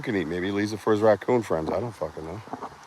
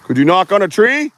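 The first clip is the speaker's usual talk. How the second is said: loud high call into the trees; 11 semitones higher, 5 dB louder than usual. Real, not acted. Hunter loudly asks Bigfoot for a sign. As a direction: call up to something hidden, loud.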